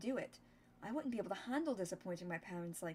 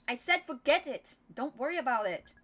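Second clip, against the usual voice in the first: loud, higher yell cutting the subject off; shouted brush-off; 3 semitones higher, 9 dB louder than usual. Acted. Min refusing to tell Dia her secret, loud brush-off shout. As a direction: shout it so she drops it. Min snaps to end the topic.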